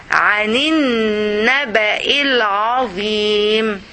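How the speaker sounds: "عن النبأ العظيم" linked as one chanted phrase; words joined. Shown as a demonstration. Teacher showing connected Quran reading, "عن النبأ العظيم" chanted joined up. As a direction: run "عن النبأ العظيم" together without breaks, long n and final vowel. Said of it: "get the words close to each other".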